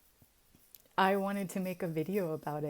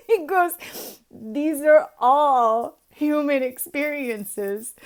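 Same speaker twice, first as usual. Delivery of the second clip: laughing; 6 semitones higher, 10 dB louder, slower than usual. Real, not acted. Laughing while quoting the wizard.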